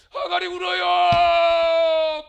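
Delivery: loud, slow wailing cry of weeping; drawn-out cry. Real, not acted. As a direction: stretch it out and shout it. Preacher cries out Hagar weeping.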